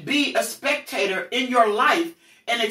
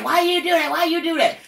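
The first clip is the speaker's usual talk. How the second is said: high-pitched imitation of protesting children; protesting kids; 6 semitones higher than usual. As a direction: plead upward like an upset kid. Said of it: "the kids said, they kept saying".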